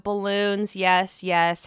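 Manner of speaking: ordinary talk, nothing marked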